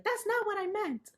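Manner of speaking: imitated viewer, high-pitched; imitating viewer. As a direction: play the confused viewer pushing back. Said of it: "then it'd be like".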